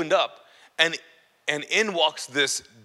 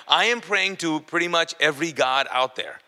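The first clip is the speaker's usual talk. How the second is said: ordinary talk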